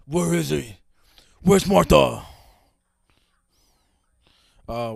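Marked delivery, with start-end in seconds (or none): 0.0-2.2 loud, put-on demanding voice; playful yelled demand, building louder; mock interrogation shout.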